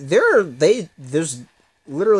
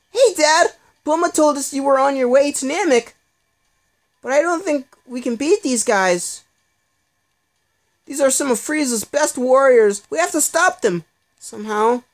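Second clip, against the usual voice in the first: put-on pitched-up eager kid fighter, urgent; young hero voice; 11 semitones higher, 4 dB louder than usual. Young fighter warning his dad about Frieza's warriors, pitched-up and urgent.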